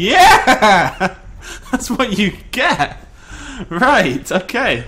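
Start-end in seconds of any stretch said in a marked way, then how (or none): 0.0-3.0 loud laughing cheer, then a slow gloat; laughing victory cheer. Real, not acted. After beating the T-Rex, a loud laughing victory cheer. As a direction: savour the win.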